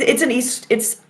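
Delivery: ordinary talk, nothing marked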